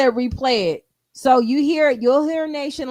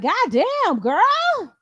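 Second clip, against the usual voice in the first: put-on higher, rising "God damn, girl"; playfully stunned reaction; mock shock; 8 semitones higher, much slower than usual. Reacting to the girl's outburst, mock shock. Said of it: "I was like".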